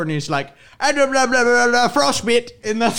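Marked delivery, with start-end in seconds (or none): 0.8-2.5 high, garbled mock Irish gibberish; hardcore Irish accent. Friend imitating a man in an Irish frostbite video, hardcore Irish accent. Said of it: "the accent is so hardcore".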